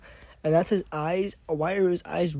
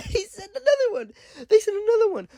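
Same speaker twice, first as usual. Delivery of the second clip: laughing; 5 dB louder than usual. Real, not acted. Laughing disbelief at another zombie.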